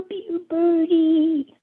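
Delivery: usual speaking voice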